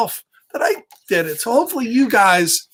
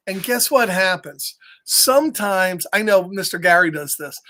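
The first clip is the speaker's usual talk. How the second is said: plain reading voice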